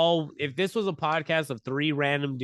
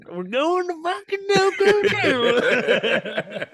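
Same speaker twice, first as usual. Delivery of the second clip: hammed-up hillbilly, high, loud and drawn out; slow, way over-the-top hillbilly accent; 14 semitones higher, 6 dB louder, much slower than usual. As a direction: holler it like a cartoon Oklahoma hillbilly. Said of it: "made it sound like some hillbilly".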